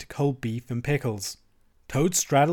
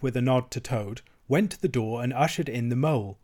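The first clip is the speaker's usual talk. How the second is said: usual speaking voice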